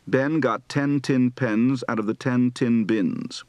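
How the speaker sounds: Southern accent, "pen" and "ten" said like "pin" and "tin"; Southern merger, slow, "ten" sounds like "tin". Shown as a demonstration. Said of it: "Southern accent practice for the I E shift".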